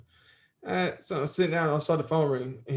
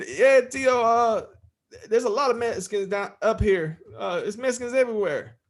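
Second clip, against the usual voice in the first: nephew's eager answer; 5 semitones higher than usual. Retold or imitated. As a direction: rattle it off eagerly.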